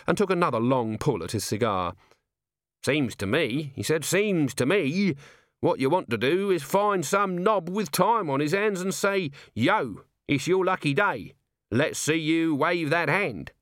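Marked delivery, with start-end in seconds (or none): 2.8-13.5 higher-pitched, rambling commoner's voice; cocky Nobby. Acted. Nobby advises the chairs on finding a king, cocky. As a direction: hold forth with cheeky confidence.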